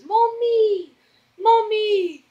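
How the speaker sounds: put-on high, drawn-out little-kid call; stretched, high-pitched toddler voice; imitating toddler. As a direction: mimic a toddler calling for mother, way up high. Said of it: "'cause he goes".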